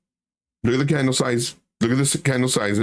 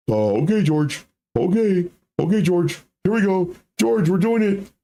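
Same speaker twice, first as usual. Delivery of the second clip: higher, eager compliant follower; gullible follower voice; 6 semitones higher than usual. Retold or imitated. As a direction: nod along eagerly, getting excited. Voicing gullible buyer.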